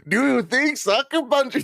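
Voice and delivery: high-pitched French attempt landing on Indian; accidental Indian accent. Host retries the question, French accent slipping into Indian. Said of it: "Now you're Indian".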